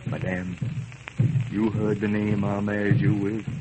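ordinary talk

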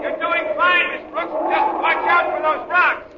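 high-pitched call down a slope; drawn-out, high shouted coaching; calling from afar. Acted. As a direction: call encouragement to someone speeding away. Calling to skier speeding away.

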